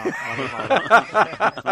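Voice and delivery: laughing. Real, not acted. Laughs at his own joke.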